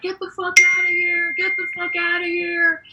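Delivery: high-pitched imitation of yelling in sleep; mock sleep-yelling. Comedian imitating dad's nightmares, high mock yell. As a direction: act out a nightmare outburst without full volume. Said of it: "yell in his sleep".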